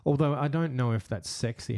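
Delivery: normal talking voice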